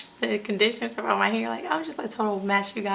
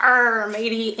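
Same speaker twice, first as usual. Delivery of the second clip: loud, growled pirate cry; hammed-up pirate exclamation; mock pirate; 7 dB louder, much slower than usual. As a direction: imitate a treasure-hunting pirate. Mimics pirate hunting for gold.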